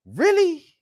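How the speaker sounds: high, indignant disbelief; incredulous. Real, not acted. Fan reacting to talk of dropping Fields, incredulous. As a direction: throw it up high in outraged disbelief.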